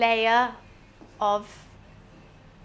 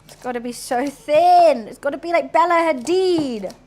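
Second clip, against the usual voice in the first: emphatic insistence; 4 semitones higher, 7 dB louder than usual. Acted. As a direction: lean hard on how thin it must be. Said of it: "having to really concentrate on this".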